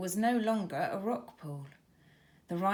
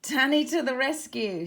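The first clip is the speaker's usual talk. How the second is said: louder, beaming praise; smiling cheer; 5 semitones higher, 7 dB louder than usual. A narrator's reading. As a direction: cheer her sister on. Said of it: "smiled Tara".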